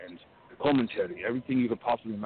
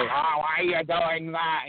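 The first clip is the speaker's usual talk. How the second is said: high, very slow put-on voice; Larry Sharpe impression; 8 semitones higher, 4 dB louder, much slower than usual. Coach quoting old trainer Larry Sharpe greeting him, high slow. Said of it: "he would come in and say".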